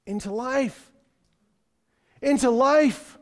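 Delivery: rising emphatic repeat. Acted. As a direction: lift the repeat into a proclamation. Preacher repeats key phrase, rising.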